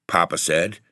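plain reading voice

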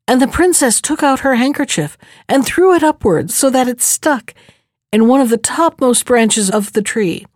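ordinary delivery, nothing marked

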